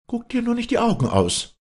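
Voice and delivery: put-on very high, loud girl's rebuke; scolding girl. A narrator's reading. Girl to staring young man, scolding in high voice. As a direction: scold him in a high girlish voice.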